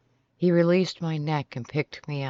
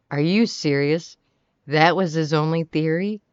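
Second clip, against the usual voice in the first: loud, rising incredulous questions; 2 semitones higher, 6 dB louder than usual. A narrator's reading. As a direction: question it in mild disbelief. Mouth dropped open at the news.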